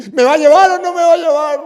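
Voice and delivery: mother pressing the priest for a ride, high and loud; demanding mother. Retold or imitated. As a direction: demand it urgently.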